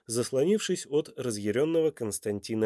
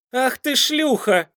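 loud, high, drawn-out insult; contemptuous outburst; 13 semitones higher, 7 dB louder, slower than usual. A narrator's reading. Duke grabs the woman, loud and contemptuous. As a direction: spit the insult slowly at high pitch.

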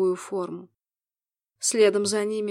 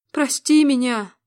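loud, slow anguished plea; begging forgiveness through tears; tearful; 4 semitones higher, 7 dB louder than usual. A narrator's reading. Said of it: "со слезами на глазах". (со слезами)

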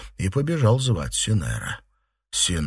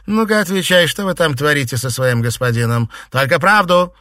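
loud, high, demanding; angry interrogation; 9 semitones higher, 8 dB louder than usual. A narrator's reading. Mistress interrogating a frightened servant, angry interrogation. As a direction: hammer out the questions at raised pitch. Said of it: "Лицо Фэнзе дышало гневом".

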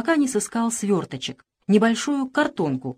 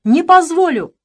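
high, loud stamping shout on позволю; desperate shout; 9 semitones higher, 8 dB louder than usual. Acted. Boy keeps shouting, stamping in despair. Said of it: "продолжал кричать Иван".

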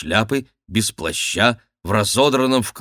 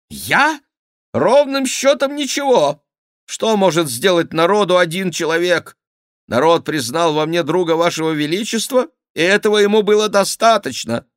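high-pitched, loud self-defence; indignant courtier; 10 semitones higher, 5 dB louder than usual. Acted. Favourite answers the king that he did nothing, high and indignant. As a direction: protest your innocence in a raised voice.

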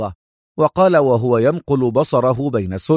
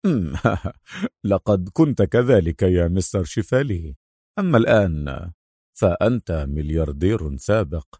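chuckle, then taunt coolly; amused dry jibe sinking low; laughing mockery; 5 semitones lower than usual. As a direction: laugh lightly before a smug put-down. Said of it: "ضحك أدهم وقال".

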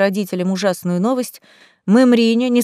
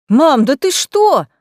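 higher, louder, slow stunned outcry to his mother; shocked gasp; 5 semitones higher, 5 dB louder, slower than usual. A narrator's reading. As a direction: gasp in disbelief at her. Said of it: "ахнул Дами".

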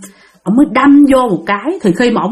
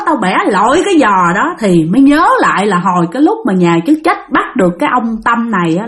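ordinary delivery, nothing marked

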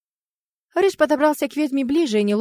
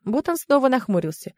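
ordinary delivery, nothing marked

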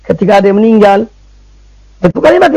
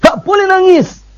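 higher-pitched mock command to hush; imitated scolding order; 8 semitones higher, slower than usual. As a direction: imitate someone ordering mourners to stop crying. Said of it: "disuruh diam semua". (meniru orang menyuruh diam)

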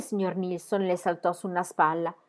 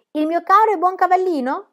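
exclaiming; 11 semitones higher, 6 dB louder than usual. A narrator's reading. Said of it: "esclamò Pippi".

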